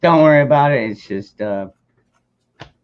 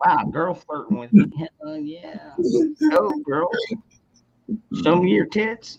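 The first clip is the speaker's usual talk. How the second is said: mock chat guy; 9 semitones higher, 3 dB quieter than usual. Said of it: "He's like".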